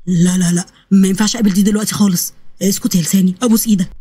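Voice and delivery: low, urgent self-talk; pleading with himself. Acted. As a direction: beg your own tongue, voice dropped low. Begging his own tongue to stay shut. (بيترجى لسانه بصوت واطي)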